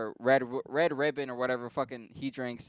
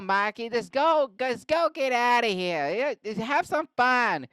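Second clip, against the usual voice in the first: high, hammed-up Boston cop voice; Boston police chief; 8 semitones higher than usual. Police chief excusing Mikey over the fire, high Boston voice. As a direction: let him off in a mock Boston accent. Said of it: "And he's like".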